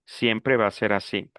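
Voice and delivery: normal talking voice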